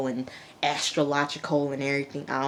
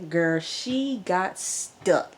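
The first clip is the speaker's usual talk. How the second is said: ordinary talk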